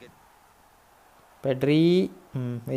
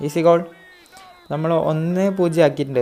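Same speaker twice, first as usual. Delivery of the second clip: usual speaking voice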